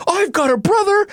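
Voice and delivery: very high, elated cry; joyful realization. Retold or imitated. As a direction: burst out with sudden joy. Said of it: "And he's like".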